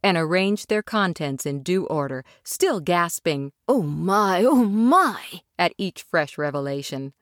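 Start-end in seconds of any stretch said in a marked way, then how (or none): 3.7-5.4 delighted gasps. A narrator's reading. Mole gasping at the food.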